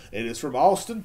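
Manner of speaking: ordinary talk, nothing marked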